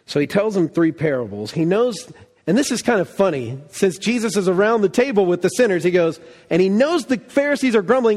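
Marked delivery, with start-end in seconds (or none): none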